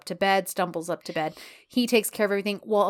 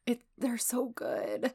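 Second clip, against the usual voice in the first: whispered; 3 semitones higher, 9 dB quieter, slower than usual. Real, not acted. Fond agreement about the couple.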